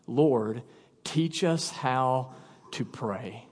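slow, low, soft request; reverent plea. Acted. Disciples asking Christ for help with prayer, reverent and hushed. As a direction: ask softly and very slowly, word by word.

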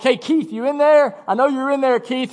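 high-pitched acted calling voice; neighbor calling in. Neighbor Gary seeks Keith at night, acted calling out. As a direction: call through a door to a sleeping friend.

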